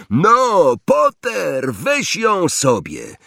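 high, taunting call from aloft; Malfoy calling out. A narrator's reading. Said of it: "i zawołał".

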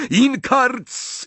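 high-pitched yell; roared spell. A narrator's reading. Harry attacking Snape, roaring the spell. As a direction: roar out the curse. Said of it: "ryknął Harry".

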